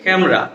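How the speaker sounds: ক্যামেরা said as kæm-ra; ক্যামেরা in two beats, m straight into r; correct two-syllable form. Shown as a demonstration. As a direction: drop the e inside ক্যামেরা. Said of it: "উচ্চারণটা হচ্ছে কি?".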